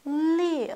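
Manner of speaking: « liu » glissant de i vers ou; « liu » haut et tombant; liu avec iu. Shown as a demonstration. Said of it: "c'est iu". (liu, pas « lieu »)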